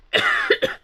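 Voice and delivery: sharp dry cough to clear the throat; frog in throat. Real, not acted. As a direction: cough out something stuck in the throat. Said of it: "j'ai un truc dans la gorge". (toux sèche pour dégager la gorge)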